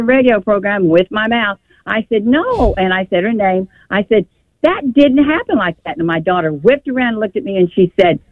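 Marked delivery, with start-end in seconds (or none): 2.3-2.7 sharp, high "No." cutting in; retold sharp no. Grandmother cutting off a lying granddaughter, sharp high no. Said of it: "Mother, don't yell at my daughter". 4.6-6.0 high, firm "That didn't happen like that."; retold raised voice. Grandmother correcting the granddaughter's lie, raised insistent voice. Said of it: "Mother, don't yell at my daughter".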